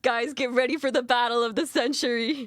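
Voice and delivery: loud, hyped arena announcer. Pretend. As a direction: build the hype like a ring announcer. Hypes the battle to viewers.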